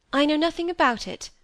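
put-on mildly defensive young woman; vexed denial. A narrator's reading. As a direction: deny it with some heat. Molly denies knowledge, still vexed.

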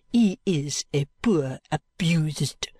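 slow whispered French sneer; sneering cry. Acted. Maid mocking Sir Leicester, whispered sneer. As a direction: hiss it with contempt. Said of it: "cries mademoiselle".

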